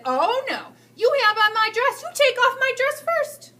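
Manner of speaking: high, loud, slow indignant squeal; shrill retort. Acted. Teacher squealing back at the principal about her dress. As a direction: refuse like a wounded, outraged teacher. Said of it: "The teacher said".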